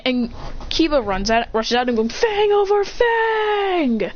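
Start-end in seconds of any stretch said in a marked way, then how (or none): 2.1-4.1 high, drawn-out battle cry; attack call. Acted. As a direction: stretch out the attack name. Kiba calls his attack.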